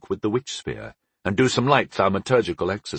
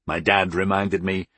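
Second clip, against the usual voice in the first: ordinary delivery, nothing marked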